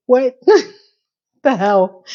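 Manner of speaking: slow, laughing through the words; real laughing disbelief. Real, not acted. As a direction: let the laugh break the line. Laughing reaction to scene.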